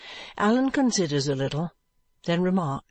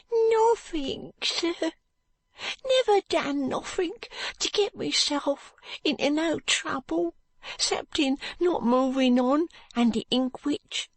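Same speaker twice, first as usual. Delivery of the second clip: put-on high, tearful boy's voice, resigned; a dying boy telling the doctor he is moving on; ragged street boy; 11 semitones higher than usual. Joe's figure voice.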